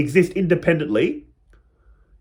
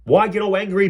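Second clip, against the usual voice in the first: normal talking voice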